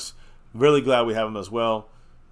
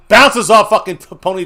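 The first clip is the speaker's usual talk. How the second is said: frustrated outburst; 7 semitones higher, 11 dB louder than usual. Real, not acted. Dropped pass, frustrated call.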